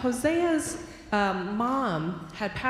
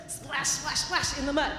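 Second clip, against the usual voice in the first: playful splashing; 5 semitones higher than usual. Acted. Boy jumping in puddles.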